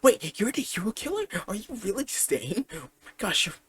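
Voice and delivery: high-pitched starstruck gushing; excited fanboying. Acted. Izuku meeting his idol Stain the hero killer, excited fanboying. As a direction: gush questions like a thrilled fan. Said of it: "Izuku starts fanboying about it".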